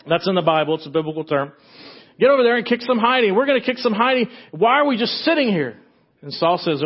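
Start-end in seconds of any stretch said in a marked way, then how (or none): 2.2-5.8 eager battle cry. Acted. David urging Saul to attack, fast and eager. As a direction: push it out fast and fired up.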